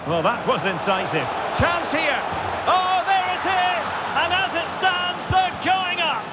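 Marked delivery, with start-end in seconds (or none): 1.6-6.2 rising to a high, sustained cry; thrilled commentator's goal cry; excited goal call. Real, not acted. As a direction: build from alert to soaring as it goes in. Commentator calls chance and goal.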